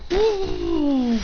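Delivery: high falling "ooh"; imitated punch noise. Storyteller imitating a punch landing, high falling noise.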